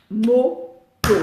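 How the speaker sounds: "Moto" in two detached loud syllables; "Moto" hammered out beat by beat; final-syllable stress. Shown as a demonstration. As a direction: say "Moto" as MO, pause, TO. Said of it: "les mots s'accentuent sur la dernière syllabe".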